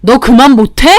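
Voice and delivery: loud, exasperated order to stop; angry brother. Acted. Brother to his ranting little sister, angry.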